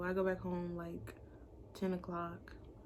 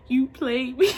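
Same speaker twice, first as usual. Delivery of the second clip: loud, higher mock wail; mock crying; 5 semitones higher, 12 dB louder than usual. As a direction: imitate a tearful accusation, loudly.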